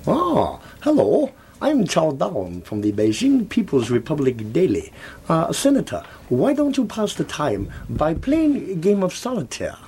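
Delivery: hammed-up fake Chinese accent; a mock Chinese accent. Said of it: "in a fake Chinese accent".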